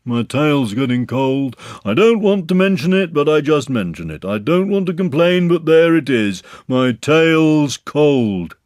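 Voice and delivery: a put-upon donkey moaning about his tail; Eeyore complaining. Acted. Eeyore complains to the others about his cold tail, gloomy.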